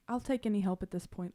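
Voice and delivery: ordinary talk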